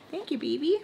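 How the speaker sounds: real soft cooing gratitude; tender thanks. Real, not acted. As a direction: thank a little one gently.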